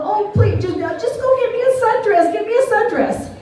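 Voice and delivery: high, whiny begging; imitated weepy plea, pitched high; retold tearful pleading. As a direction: beg in a mock-crying voice. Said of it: "I'm crying".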